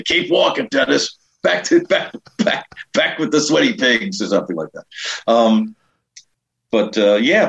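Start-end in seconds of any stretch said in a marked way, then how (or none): 0.0-4.2 high-pitched mock heckle; teasing travel companions. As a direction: imitate first-class flyers sending someone to the back. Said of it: "they'd say".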